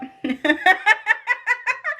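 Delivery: can't hold the laugh in, high and free; high burst of laughter. Real, not acted. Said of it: "Let me laugh".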